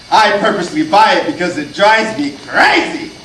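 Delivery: ordinary talk, nothing marked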